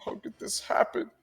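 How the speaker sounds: high, pained wail of a heartbroken man; grieving Alden. Acted. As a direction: wail over the dead hunting dog. Alden still mourning his dog.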